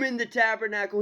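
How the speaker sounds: normal talking voice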